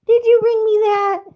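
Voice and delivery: very high, loud squealy question. Real, not acted. Owner delighted her dog brought the Kong, very high baby talk.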